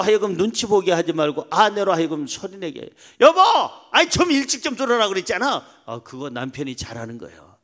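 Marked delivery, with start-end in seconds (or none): 3.2-5.6 high-pitched wifely complaint; high-voiced nagging wife. Wife telling off her husband for coming home late, high-voiced nagging. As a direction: nag loudly up high, freely. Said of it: "아내가 소리가 그 정도 높으면은".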